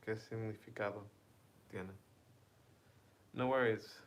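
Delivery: usual speaking voice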